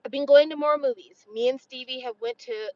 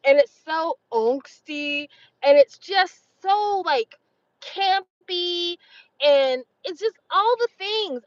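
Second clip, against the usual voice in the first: gushing excitement; 6 semitones higher, 4 dB louder, slower than usual. Real, not acted. Gushing about campy vampire movies.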